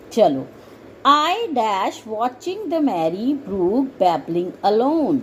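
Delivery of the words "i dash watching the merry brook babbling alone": slow reading. Shown as a demonstration. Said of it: "reads blank exercise".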